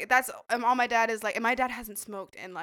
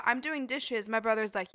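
ordinary talk

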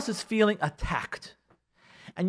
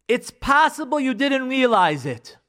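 slow, louder, deliberate delivery; careful opener; 7 semitones higher, 5 dB louder, slower than usual. Shown as a demonstration. Showing couples how to open a hard talk, deliberately. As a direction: lay it out slowly and emphatically. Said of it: "here's what you have to say".

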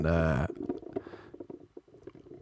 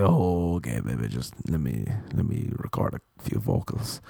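deep, low breathy murmur; 9 semitones lower, 5 dB quieter than usual. Retold or imitated. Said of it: "He's like".

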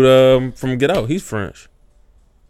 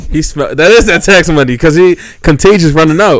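laughing; 6 semitones higher, 8 dB louder than usual. Real, not acted. Joking about [name]'s tax money.

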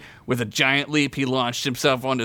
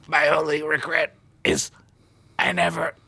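halting, higher dying-character voice; dying last words; 5 semitones higher than usual. A narrator's reading. Slain pig man on the sand, hammed-up dying words. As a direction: gasp out a final regret in pieces. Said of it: "were his last words".

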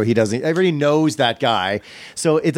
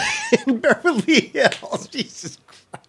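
laughing; 12 semitones higher, slower than usual. Real, not acted. Laughing at best barista joke.

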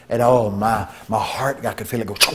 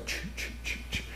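imitated light zap; 19 dB quieter than usual. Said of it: "and it goes".

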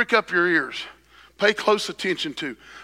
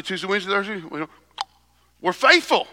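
normal talking voice

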